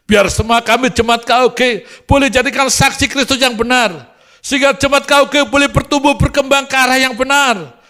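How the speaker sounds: high, loud, impassioned intercession; ringing revival prayer, gradually easing; fervent prayer. Acted. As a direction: pray at full force over the congregation. Prayer rises to full force.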